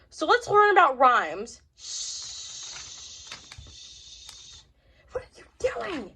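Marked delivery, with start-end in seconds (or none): 1.8-3.3 a hushed, breathy shh; shushing. Acted. Hushing in class. 5.1-6.1 asked much softer, almost whispered; hushed question. Acted. Whispering in class to friend.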